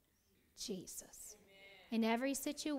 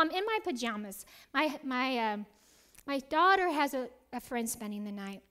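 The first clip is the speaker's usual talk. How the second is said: ordinary delivery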